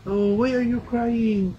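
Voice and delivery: high, slow coaxing sing-song. Real, not acted. Mother urging her toddler to say hi, high slow coax.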